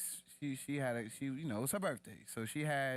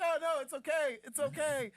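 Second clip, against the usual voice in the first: high, louder, breezy it's-okay voice; 14 semitones higher, 5 dB louder than usual. Retold or imitated. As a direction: mimic the drenched guy's easy calm. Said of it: "he was like".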